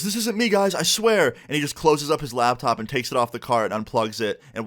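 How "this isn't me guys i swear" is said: high-pitched pleading mock yell; yelled denial. Embarrassed classmate to the whole class, mock yelled denial. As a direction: imitate a panicked classmate protesting innocence. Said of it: "he just yells out".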